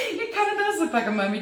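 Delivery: high, quick words tumbling out of a laugh; laughing agreement. Real, not acted. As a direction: agree while still cracking up. Still amused by mummy comment.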